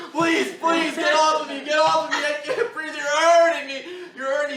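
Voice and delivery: pinned in a chokehold, yelling for mercy; panicked begging. Retold or imitated. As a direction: beg him to let go, voice straining high.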